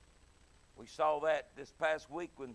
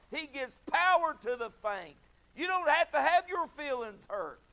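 preaching scripture; 6 semitones higher, 8 dB louder than usual. Acted. Preacher reads Isaiah to the discouraged congregation, full preaching voice. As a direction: thunder the promise from Isaiah.

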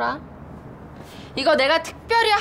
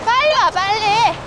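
calling out; 7 semitones higher, 5 dB louder than usual. Acted. Calling friend up.

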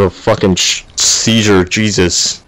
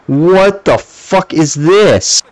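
slow, higher, outraged question; drawn-out disbelief; 7 semitones higher, much slower than usual. Real, not acted. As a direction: stretch it out in disbelief. Slow incredulous complaint.

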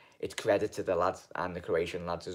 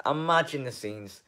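Imagine the scenes lifted higher with excitement; excited repeat; 5 semitones higher than usual. Real, not acted. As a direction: say it again, now thrilled by the idea. Repeats phrase, second lifted.

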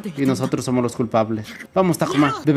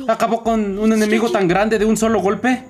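higher, excited hero voice reading the dialogue; game character voice; 7 semitones higher than usual. Acted. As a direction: play the astonished kid hero. (voz de personaje animado)

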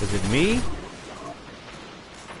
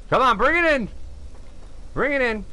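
high, raised beckoning call; urging call; 11 semitones higher than usual. Pretend. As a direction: call it in from a distance. Urges the ship to come closer.

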